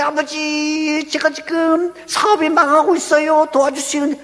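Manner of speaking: high-pitched acted plea to Father; pleading prayer. Believer praying over a failing business, high pleading prayer. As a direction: act out a needy believer praying aloud. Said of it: "내가 기도할 때".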